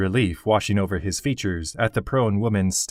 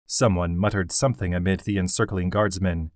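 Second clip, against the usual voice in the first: ordinary delivery, nothing marked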